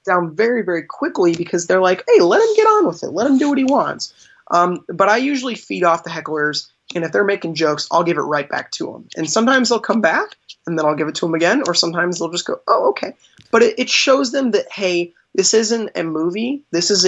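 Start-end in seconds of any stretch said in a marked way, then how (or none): 2.1-4.1 high-pitched mock protest; imitated crowd protest. Audience telling a heckler to back off, high mock protest. Voices crowd defending the performer. 12.7-13.1 high-pitched imitated concession; mock backing down. Heckler giving in to the magician, high mock concession.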